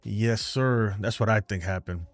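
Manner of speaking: ordinary talk, nothing marked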